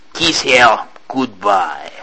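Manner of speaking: very slow, deliberate captain; captain ordering Poirot to kiss the head; slow cruel command. Acted. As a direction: draw out each word coldly.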